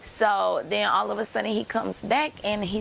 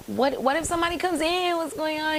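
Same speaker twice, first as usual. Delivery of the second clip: alarmed; 8 semitones higher than usual. Retold or imitated. Alarmed question to barber.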